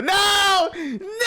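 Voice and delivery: high piercing shrieks; startled screaming protest; shrieking no. Acted. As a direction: shriek as cold water hits you. Drenched by shaking wolf.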